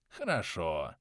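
ordinary delivery, nothing marked

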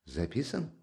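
hushed one-word question; quiet surprise. Acted. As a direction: ask it under your breath. Raskolnikov breaks in with question.

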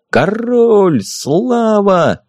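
crowd cheer. A narrator's reading.

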